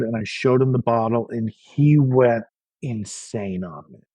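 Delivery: usual speaking voice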